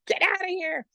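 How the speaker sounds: squealing disbelief. Retold or imitated. To Wendy hearing about Master Ko, squealing high in disbelief. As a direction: squeal it in gleeful shock.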